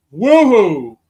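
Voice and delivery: loud woohoo cheer; excited woohoo. Real, not acted. Celebrating last day.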